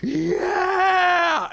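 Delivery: high, drawn-out scream of "yeah"; loud, high exultant shriek; screamed yell. Retold or imitated. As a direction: scream it at full tilt like a wild man at a gig. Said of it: "shakes me and just".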